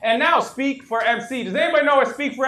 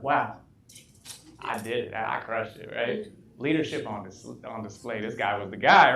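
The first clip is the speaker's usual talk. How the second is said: much lower and softer, proud to himself; smug inner voice; 11 semitones lower, 7 dB quieter than usual. Retold or imitated. Recalling his private thought after the meeting, low and soft. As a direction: murmur the self-praise low and quiet.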